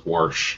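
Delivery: "warsh" with an added R; regional pronunciation. Retold or imitated. Imitating dad from Kentucky, "warsh" with an R. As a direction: say wash as "warsh". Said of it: "he always says".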